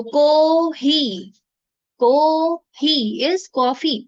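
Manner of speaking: को ही with both vowels stretched long and slow; को ही held long, syllable by syllable; long vowel koohii. Shown as a demonstration. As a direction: draw out को and ही, then say is coffee. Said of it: "को को थोड़ा सा long बोलना है".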